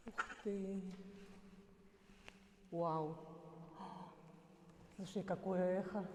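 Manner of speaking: soft amazed murmur; quiet, wondering voice; hushed awe. Real, not acted. Hushed awe inside echoing cistern.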